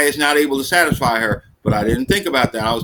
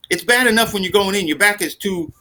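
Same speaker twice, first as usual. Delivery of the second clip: ordinary talk, nothing marked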